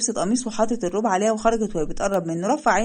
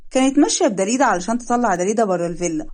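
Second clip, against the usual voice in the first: usual speaking voice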